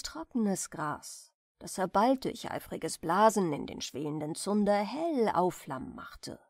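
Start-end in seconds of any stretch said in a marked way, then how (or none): none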